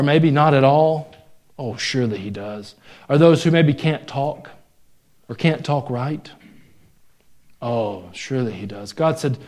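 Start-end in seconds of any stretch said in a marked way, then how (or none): 1.6-2.7 soft aside. Acted. Answering his own question softly. 7.6-8.9 soft aside. Acted. Answering his own question softly.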